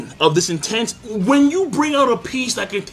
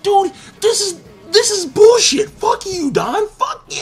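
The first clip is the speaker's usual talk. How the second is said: high, mock-furious yelling; outraged yell; 13 semitones higher than usual. Recapping an anime, yelling at Don in mock outrage. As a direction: squeal it out in disbelief. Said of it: "I'm like".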